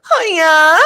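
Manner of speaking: high wailing yell; loon call. Retold or imitated. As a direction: wail it out like a loon. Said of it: "my kids, they go".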